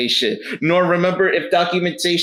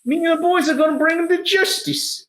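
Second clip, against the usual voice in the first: high, wheedling official voice; hint at a bribe in a high guard voice; bribe-seeking guard. Acted. Guard angling for money from travelers, high wheedling voice.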